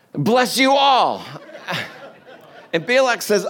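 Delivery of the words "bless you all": slow, high, louder mock-solemn benediction; drawn out, hammed-up blessing with a laugh; grand blessing. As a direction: bestow it like a pompous prophet. Prophet blessing grandly again.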